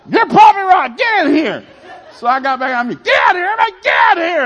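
high, loud ordering shout; commanding yell. Retold or imitated. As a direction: bark the family into place. Yelling family into circle.

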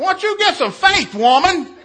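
very high, full-volume scolding; hammed-up high, loud yell; panicking spiritual outburst. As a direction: shout a preachy rebuke in panic. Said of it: "'cause I'm panicking".

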